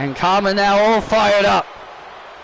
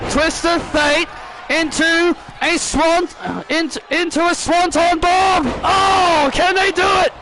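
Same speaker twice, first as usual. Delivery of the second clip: excited play-by-play; 6 semitones higher than usual. Acted. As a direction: call the big move like a hyped commentator.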